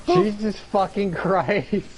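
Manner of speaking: a slow stunned outburst after a sharp breath in; shocked gasp. Real, not acted. Shocked at game chaos.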